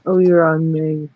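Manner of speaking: normal talking voice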